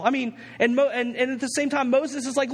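ordinary delivery

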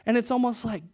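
usual speaking voice

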